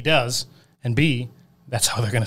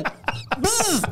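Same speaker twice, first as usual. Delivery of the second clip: buzzing noise. Retold or imitated. Imitating a sound after quote.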